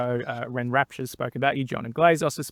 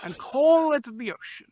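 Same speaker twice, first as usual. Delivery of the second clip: very high, hammed-up poet's climax; Joel McCarroll impression; 12 semitones higher than usual. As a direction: finish the mock poem in a high, straining recital. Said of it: "here's my Joel McCarroll".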